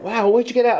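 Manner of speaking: high, amazed retelling of a customer. Retold or imitated. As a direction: mimic someone stunned by a find. Customers amazed at found medals.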